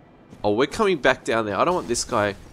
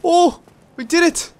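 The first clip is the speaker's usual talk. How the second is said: high, loud triumphant shout; victory yell; 9 dB louder than usual. Real, not acted. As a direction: cheer the win. Victory after jump.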